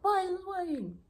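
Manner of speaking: high, exasperated mock complaint; frustrated. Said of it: "you're getting frustrated and you're like".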